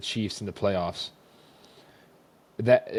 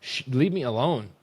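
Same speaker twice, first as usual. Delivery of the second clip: mildly heated retold outburst, raised pitch; retold snapping; 4 semitones higher than usual. Snapping at girls who keep testing him, mock irritated. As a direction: act out finally losing patience. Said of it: "I finally snapped and got mad".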